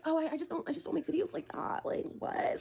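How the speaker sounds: high, soft mimicry of a boyfriend; mock meek excuse. Imitating the boyfriend declining to make videos, mock meek. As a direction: shrink it down to a small, high voice.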